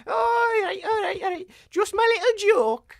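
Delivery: high, shaky laughing retreat; nervous backpedal. Acted. Jack O'Dory backing down from Fog, high nervous laugh.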